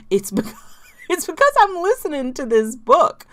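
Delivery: giggling through the words, half whispered; a real giggly aside; laughing explanation. Real, not acted. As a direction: laugh it out sheepishly. Laughs explaining her accent.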